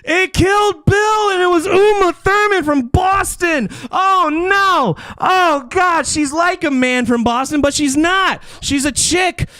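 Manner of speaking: high, drawn-out wailing announcement; high-pitched shouted reveal, stretched out; dramatic game-show reveal. Real, not acted. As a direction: holler it like a calamity on a game show. Host's mock-dramatic losing reveal.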